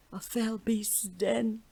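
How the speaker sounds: slow, high, hushed and scared; frightened whisper. A narrator's reading. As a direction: whisper it in dread. Second girl interjects, frightened.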